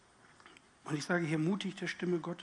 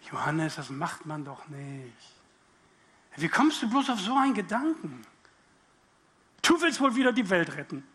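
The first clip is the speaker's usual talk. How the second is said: scolding inner voice; 6 dB louder than usual. Pretend. Inner voice rebuking Johannes for wanting to help, scolding. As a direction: scold yourself like a reproachful conscience. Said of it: "weißt du, was passiert?".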